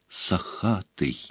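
very soft, slow, hushed utterance; whispered. A narrator's reading. Harry recognising the silver stag, whispering slowly. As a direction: breathe the name out slowly and quietly. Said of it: "прошептал он".

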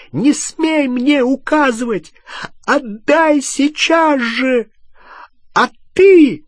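high, drawn-out screamed commands; enraged yelling. Acted. Hermione turning from Harry onto Ron, enraged screaming. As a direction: build to a loud accusing cry. Гермиона кричит на Гарри и Рона.

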